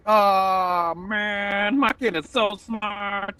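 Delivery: high, drawn-out boast in a put-on voice; gushing parent. Parent boasts about child's brains, mock gushing. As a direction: imitate a besotted parent, stretching it out high.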